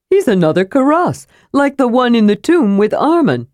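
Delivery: high, lively kender voice; excitable kender. Acted. Kender spotting yet another Karas, high and excitable. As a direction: pipe up high with sudden recognition.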